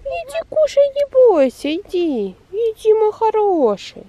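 high, cooing call to a pet; coaxing a pet. Real, not acted. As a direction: coax a timid cat closer. Coaxing a shy cat.

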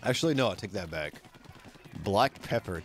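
ordinary talk, nothing marked

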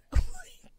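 shrieking laugh; high burst of laughter. Real, not acted. Laughs after retelling.

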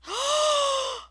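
loud high excited gasp; sharp high gasp. Real, not acted. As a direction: gasp in delight. Sudden find while digging.

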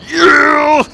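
high, loud triumphant yell; excited cheer. Real, not acted. Gamer cheering a win on stream, loud. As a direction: shout out a victory whoop.

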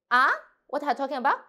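didn't hear you, ask quickly; doubtful question. Shown as a demonstration. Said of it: "a little bit doubt".

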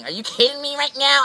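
real frustration at the rain, shouted; upset outburst. Real, not acted. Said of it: "I get really upset".

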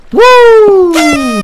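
very high, loud "woo!"; excited whoop. Real, not acted. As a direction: let out a victory whoop. Cheers a success in game.

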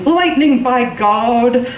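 high mock cry of alarm; mock yell. A narrator's reading. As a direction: imitate startled neighbours yelling. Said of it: "Neighbors yelling".

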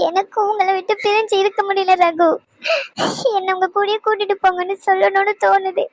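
sobbing plea; crying. Acted. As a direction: beg him through tears. Wife begs husband not to leave. (அழுகையுடன் கெஞ்சல்)

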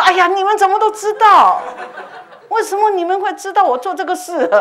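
high loud laughing exclamation; incredulous laughing cry. Retold or imitated. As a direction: protest in amused disbelief. 惊讶鬼都知道. (对女鬼笑着惊叫)

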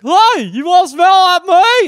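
high, loud, slow goofy cartoon voice; dopey cartoon dog shouting out slowly. Goofy perfume mascot greeting people, high loud cartoon voice.

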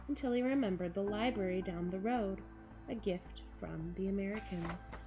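ordinary delivery

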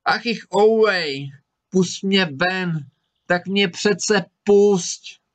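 high, very slow, drawn-out pleading; plaintive wail. A narrator's reading. Tricked spirit begs the student to free him, plaintive wail. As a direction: wail pitifully, stretching every word. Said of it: "ale duch začal žalostně volat".

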